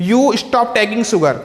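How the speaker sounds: slow dictation. Shown as a demonstration. Teacher reads example sentence slowly.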